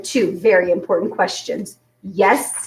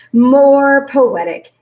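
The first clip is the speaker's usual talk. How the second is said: emphatic; 5 semitones higher, 7 dB louder, much slower than usual. A narrator's reading. William Spiver pompous.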